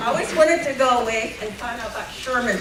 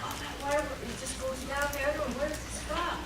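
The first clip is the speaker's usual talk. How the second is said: scared grandma; 3 semitones higher, 11 dB quieter than usual. Retold or imitated. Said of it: "She was all scared".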